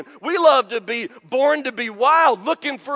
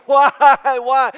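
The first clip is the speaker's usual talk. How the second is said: mock wail; 3 semitones higher, 11 dB louder than usual. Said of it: "screaming and wailing".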